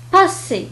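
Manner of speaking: "باسي" with voiceless s in the middle; double S as seen. Shown as a demonstration. Teacher showing double S, clear s in "باسي". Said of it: "ينطق كذلك كحرف السين".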